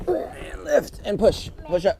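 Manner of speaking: straining while lifting; carrying heavy load. Real, not acted. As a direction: push the words out under a heavy lift. Lifting heavy box together.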